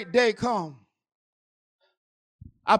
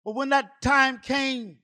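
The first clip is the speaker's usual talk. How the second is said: ordinary talk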